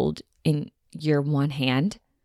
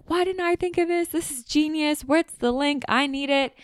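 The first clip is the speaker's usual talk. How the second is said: much higher, eager fan voice; excited followers; 10 semitones higher than usual. Retold or imitated. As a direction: gush like fans begging for the link.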